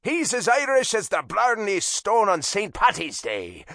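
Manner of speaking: loud, high Irish dad calling; proud Irish father boasting; Irish accent. A narrator's reading.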